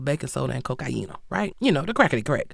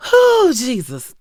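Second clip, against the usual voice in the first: loud, slow, high exhaled "whew"; exasperated exclamation; 6 semitones higher, 9 dB louder, slower than usual. Real, not acted. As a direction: blow off steam in disbelief. Exasperated outburst after the rant.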